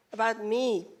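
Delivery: normal talking voice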